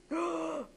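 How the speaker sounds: quick, soft gasp; alarmed gasp. Acted. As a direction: suck in a breath at the disaster. Gasp as the cows arrive.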